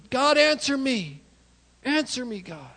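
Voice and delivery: desperate plea. Retold or imitated. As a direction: beg God to answer, dying away. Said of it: "He was, yeah".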